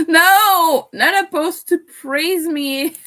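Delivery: choked up, laughing through tears; half laughing, half crying; tearful laugh. Real, not acted. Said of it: "I'm gonna cry".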